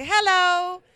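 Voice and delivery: high, loud "hello"; loud greeting. Retold or imitated. Patient greeting staff between the stages of sedation, loud greeting. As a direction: call out a big hello on waking.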